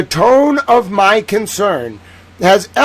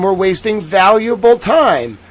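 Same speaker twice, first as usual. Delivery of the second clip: ordinary delivery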